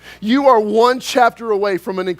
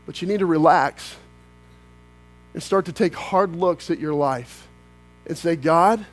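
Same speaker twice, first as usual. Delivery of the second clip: lower, softer, reflective; quiet counsel; 6 semitones lower, 5 dB quieter than usual. Acted. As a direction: draw them in quietly.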